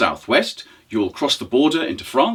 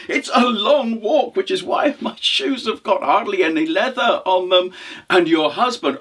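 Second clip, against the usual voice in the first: crafty traveller; 7 semitones higher than usual. Acted. Poor traveller fooling the widow, eagerly bluffing. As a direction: agree eagerly while making it up.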